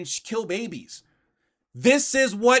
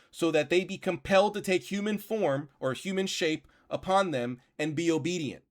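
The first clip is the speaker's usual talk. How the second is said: put-on measured, quieter reading of an old text; reading aloud; 3 semitones lower, 4 dB quieter than usual. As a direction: read the old passage steadily, a touch lower. Reads the grimoire aloud.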